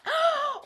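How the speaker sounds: a high, startled gasp; sharp gasp. Real, not acted. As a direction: gasp in surprise. Startled in the attic.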